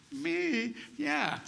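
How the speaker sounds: high-pitched, disbelieving little exchange; imitated surprise. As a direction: squeak it in mock amazement. Mother's surprise and child's answer, retold.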